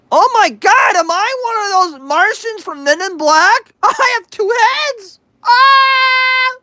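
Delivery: high, hammed-up shrieking alarm; mock panic. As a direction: freak out in jest. Mock panic about two heads.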